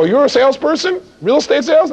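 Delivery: high, challenging questions; incredulous homeowner. Acted. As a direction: question the caller in disbelief. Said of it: "They knock on the next door and they go".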